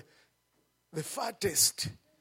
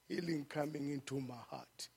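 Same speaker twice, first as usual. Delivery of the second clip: ordinary talk, nothing marked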